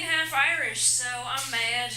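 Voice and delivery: ordinary talk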